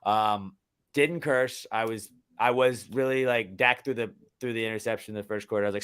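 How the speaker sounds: usual speaking voice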